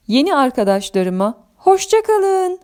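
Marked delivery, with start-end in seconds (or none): 1.6-2.6 tiz, yavaş, uzatılmış veda; veda seslenişi. Acted. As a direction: uzaktan seslenir gibi uzat. (neşeli, tiz vedalaşma)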